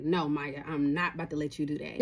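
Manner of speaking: low-voiced, understated refusal; imitating male friend. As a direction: drop the voice to play him saying no. Friend refusing to let her out.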